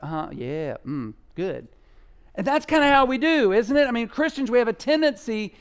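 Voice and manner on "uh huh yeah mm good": mock lazy agreement. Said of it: "it's like".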